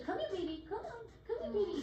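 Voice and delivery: high coaxing call to the dogs; bird copying owner. Retold or imitated. Parrot calling the dogs at feeding time, high and coaxing. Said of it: "he'll say".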